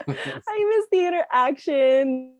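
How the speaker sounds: said through a high laugh, slowly. Real, not acted. Laughing as she tells the host she misses the interaction. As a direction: let the laugh carry the words up high.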